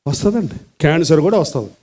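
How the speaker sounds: ordinary talk, nothing marked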